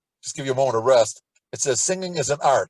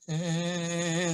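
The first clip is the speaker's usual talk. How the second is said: softer gentle Hey; soft start; 3 semitones higher, 6 dB quieter than usual. Shown as a demonstration. Teacher starting messa di voce, soft gentle Hey. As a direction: begin it barely above a whisper. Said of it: "start with a whisper".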